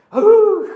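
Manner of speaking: loud, high frustrated howl. Retold or imitated. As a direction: let out a sudden high "ugh" yell. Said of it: "소리 지르면서". (답답해서 내지르는 고함)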